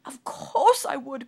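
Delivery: loud, high, slow cry; king cries back at Sunshine. Acted. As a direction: cry it out desperately. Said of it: "cried the king".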